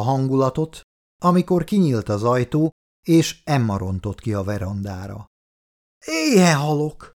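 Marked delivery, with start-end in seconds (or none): 6.1-7.1 high, drawn-out excited whine of hunger; child's cry. A narrator's reading. Little girl bursting out to her father, high hungry cry. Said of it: "kiáltotta".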